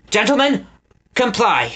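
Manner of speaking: high curt order; snapped. A narrator's reading. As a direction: bite off the command. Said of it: "Lord Portly Rhyne snapped".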